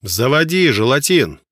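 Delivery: loud barked command; shouted order. Acted. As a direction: yell at the driver to start.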